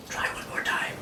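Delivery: soft coaching whisper. Acted. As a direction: whisper it like a secret. Quietly coaching before wave.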